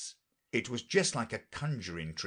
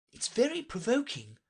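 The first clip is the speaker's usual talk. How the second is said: put-on high-pitched girlish character voice; Alice, far higher than the narration; young Alice. Alice chatting to the unicorn, high and girlish.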